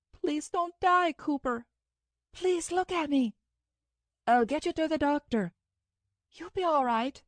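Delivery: high tearful plea; crying. A narrator's reading. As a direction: beg him not to die through tears. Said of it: "So why are you crying?".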